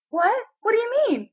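put-on high, puzzled old-lady voice; old lady, bewildered. Old lady baffled by the pranksters' question, high and bewildered. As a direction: ask in alarm as an old lady. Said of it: "it's this old lady".

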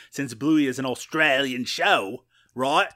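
put-on Australian accent. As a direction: slide into a hammy Australian accent. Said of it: "slide into an Australian accent".

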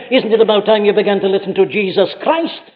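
ordinary talk, nothing marked

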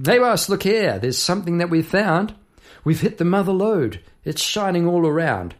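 high, eager American-tinged miner's call; a digger thrilled at striking gold; excited digger. Acted. Miner shouting to his boss about the gold strike, excitedly. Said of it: "created some voices".

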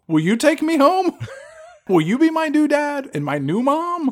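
mock lost child; whiny kid voice. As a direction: plead like a lost little boy. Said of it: "he was just like".

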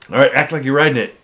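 mock drill instructor to a recruit; fast drill instructor order.

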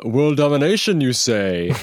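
villainous robot, slow and menacing; sinister robot voice. Acted. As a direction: say it like a robot plotting world domination. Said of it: "Be like".

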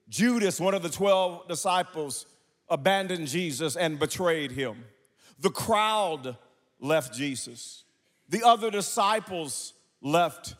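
high, loud chanted preaching; full-force preaching. Acted. Preacher chanting Jesus' suffering to congregation, loud fervent whoop. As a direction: build the congregation's fervor.

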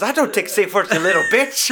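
mock dominatrix. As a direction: put him in his place, laughing through it. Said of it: "this woman's fucking dominating him".

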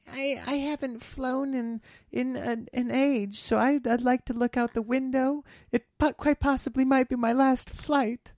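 high-pitched, put-on passenger voice; imitated woman passenger. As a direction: plead for the window seat in a high mock voice. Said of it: "she, she".

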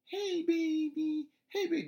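put-on squeaky sing-song baby talk to a dog; high-pitched baby talk. Imitating a stranger cooing at the dog in baby talk. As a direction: coo it slowly in a tiny high voice. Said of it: "This little high-pitched voice".

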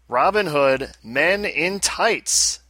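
drawn-out title. Acted. Host announcing episode title.

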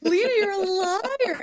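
hammed-up accusing cry, imitating a dramatic delivery; drawn-out accusation. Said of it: "this amazing delivery".

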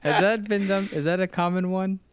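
high-pitched, amused question. Real, not acted. Host asking co-host about name mix-ups, amused and high-pitched. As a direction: ask it high, just after laughing.

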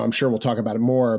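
normal talking voice

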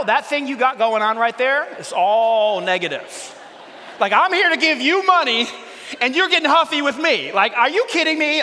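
loud, high exasperated rant; what I almost said. Retold or imitated. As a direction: let the bank guy have it, hammed-up. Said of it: "And I almost said".

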